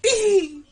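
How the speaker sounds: high mock "ee" squeal; imitated squeal. Commentator imitates Booker flying through the air, high mock squeal. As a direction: squeal like someone sent flying. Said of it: "and Booker's like".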